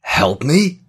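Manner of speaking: high, loud incredulous question; scornful disbelief. A narrator's reading. Joel scoffs at the offer of help, loud and incredulous. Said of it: "he barked out a laugh".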